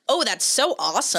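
put-on British take on an American accent; exaggerated American accent. Mocking how Brits do American accents. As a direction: gush awesome in a hammed-up American accent. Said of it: "a little exaggerated".

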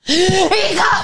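high mock shout; mother calling out. Mother calling her daughter while half asleep, high shout. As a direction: call out to your daughter in alarm. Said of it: "mi mamá así dormida".

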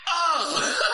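high loud squeak of panic; mock panicked yelp. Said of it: "I was just like".

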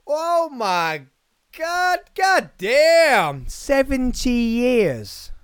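high, long drawn-out amazement; astonished outburst. Real, not acted. Podcaster discovering how long the Queen has reigned, astonished. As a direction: stretch each word out high in disbelief.

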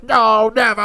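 high, loud mock cry of refusal; hammed-up furious listener; mock outrage. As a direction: wail it like an outraged fan. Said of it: "he just threw his iPod".

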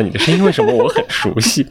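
a lightly acted puzzled question, pitched a bit up. Strangers puzzled on hearing the Siri woman's voice, mildly baffled. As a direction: sound mildly baffled by a voice you half know. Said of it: "经常会有人很困惑地看着你".

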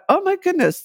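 a jolt of recalled alarm; surprised. Retold or imitated. Wife recalling her shock at the bacteria's name, surprised. Sudden realisation in remembered thought.

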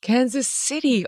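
high, drawn-out echo of disbelief; mock amazed. Host learning the president's hometown, mock amazed. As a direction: repeat it slowly in high-pitched wonder. Host feigns surprise at his origin.

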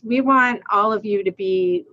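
ordinary delivery

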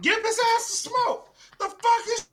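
high scolding, slow at the end; mocking dad. Dad scolding crying Caillou, high slow scold. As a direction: scold him, stretching the last words.

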